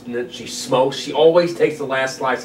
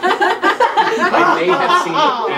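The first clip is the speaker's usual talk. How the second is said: laughing; 8 dB louder, much slower than usual. Real, not acted. Coy admission, laughing.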